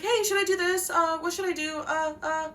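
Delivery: high, flustered stammering. Retold or imitated. The speaker running to someone for advice, high and flustered. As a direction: dither nervously for advice. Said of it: "If I'm like".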